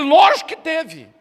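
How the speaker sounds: loud, high, slow stubborn protest; indignant retort. Retold or imitated. Tired father arguing with the Holy Spirit, loud indignant protest. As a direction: push back hard, offended. Father insists against God.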